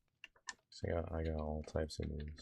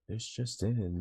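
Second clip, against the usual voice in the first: loud, punchy breaking-news opener; news reporter voice; 5 semitones higher, 4 dB louder, slower than usual. Pretend. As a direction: announce it like a TV anchor. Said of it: "news reporter voice".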